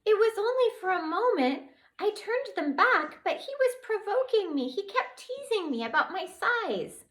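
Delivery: tiny fairy justifying herself to the twins; upset tiny fairy. Acted. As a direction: defend yourself, tiny and upset.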